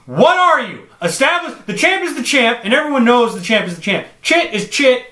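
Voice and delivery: high, loud indignant rant; exasperated shouting at co-host; incredulous outburst. Real, not acted. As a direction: demand an answer, pitch way up. Mock-outraged interrogation.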